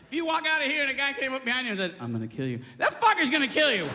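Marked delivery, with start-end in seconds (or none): 2.0-2.6 very low, quiet, level threat; voice of death. Retold or imitated. Stranger behind you at night, low calm menace. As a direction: say it deep and dead calm. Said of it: "That's the voice of death".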